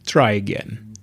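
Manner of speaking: slow, gravelly god voice; gravelly deep voice. Acted. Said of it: "The voice came out gravelly and deep".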